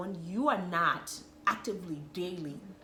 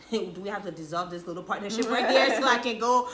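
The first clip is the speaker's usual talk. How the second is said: laughing mock exasperation; 5 semitones higher, 11 dB louder than usual. Retold frustration with husband.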